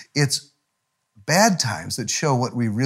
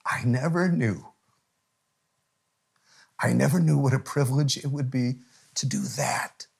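soft, hushed retold crying; imitating tearful confession; 4 dB quieter than usual. Crying friend confiding to his pastor, retold in a hushed voice. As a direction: let it slip into a moved near-whisper. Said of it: "he's crying".

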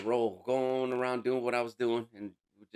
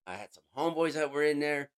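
ordinary delivery

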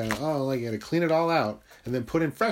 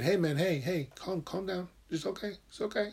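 soft, higher-pitched calming voice; hushed reassurance to someone worked up; soothing self-talk; 6 semitones higher, 6 dB quieter than usual. Acted. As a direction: talk yourself down gently. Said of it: "reminding myself every day".

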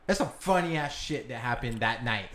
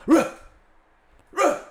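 short mock dog barks, Ruh; dog bark imitation; 14 semitones higher, 6 dB louder than usual. Owner retelling how his stuck dog barked, mock barks. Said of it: "doing the little".

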